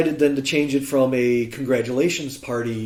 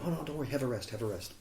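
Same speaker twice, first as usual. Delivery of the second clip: softer, brushing-off host, lightly voiced; typical Chinese host; 12 dB quieter, much faster than usual. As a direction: wave him off gently, a hint of a polite host. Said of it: "typical Chinese line".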